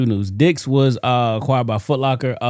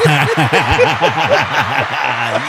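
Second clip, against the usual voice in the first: loud laugh; 2 semitones higher, 5 dB louder than usual. Real, not acted. Laughing after shout.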